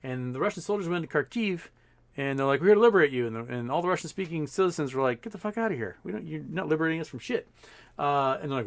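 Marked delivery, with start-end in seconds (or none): 2.6-3.3 louder, higher proclamation; mock soldier announcement. Russian soldiers arriving in Kharkiv, mock proud announcement. 5.2-7.5 a higher, scornful brush-off; mock indignant retort. Kharkiv citizens to Russian soldiers, mock scornful retort.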